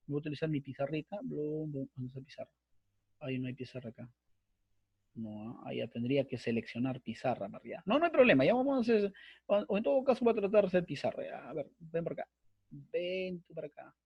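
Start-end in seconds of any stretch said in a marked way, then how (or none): 0.1-7.8 much softer, half-swallowed words to himself while searching the screen; low murmur, not meant for the class; muttering to himself. Real, not acted. Teacher searching for the whiteboard, muttering softly to himself. 11.2-13.9 soft coaxing under his breath; muttering to the screen. Real, not acted. Teacher coaxing the whiteboard tool into place, softly muttering.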